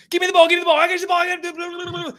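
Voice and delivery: very high excited squeal; high-pitched frantic movie-quote voice; frantic Jon Favreau. Retold or imitated. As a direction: yelp it like a panicked football player. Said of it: "Jon Favreau was like".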